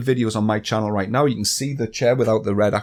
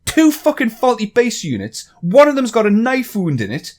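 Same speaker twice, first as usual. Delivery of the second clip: higher, exasperated ranting; heated rant; 8 semitones higher, 3 dB louder than usual. Real, not acted. Vlogger venting at the chair company, heated raised rant. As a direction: vent the outrage in a raised voice.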